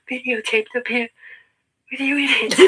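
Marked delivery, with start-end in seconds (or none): 1.9-2.6 laughing taunt. Real, not acted.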